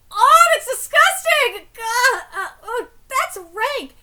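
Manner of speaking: loud, slow, high cry of disgust; disgusted shout. Acted. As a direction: recoil and yell at the stink. Character reacts to the stench.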